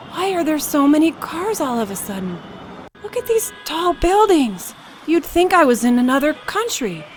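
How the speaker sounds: a grandpa gaping at tall buildings; hammed-up bewildered grandpa; astonished old man. A narrator's reading. Old man shocked by city.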